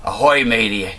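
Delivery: lightly growled pirate greeting; hammy pirate captain hailing the crew. Acted. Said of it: "progressive liberal pirate ship".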